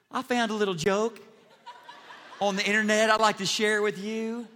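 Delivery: light Texas twang, a little laugh in it; playing a folksy pastor with a chuckle; Joel Osteen twang. Pastor mimicking Joel opening service with a joke, light twang. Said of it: "talk with a Texas twang like Joel".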